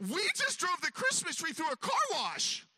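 high, loud dawning horror; stunned realisation. Retold or imitated. Telling the dog what they just did, stunned. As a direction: blurt out the awful truth. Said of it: "I'm like".

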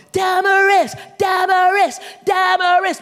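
high, loud chant of an adoring crowd; high-pitched fan chant, drawn on one note; screaming crowd. Acted. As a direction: shout the name like a packed stadium. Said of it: "fill that place up and scream out".

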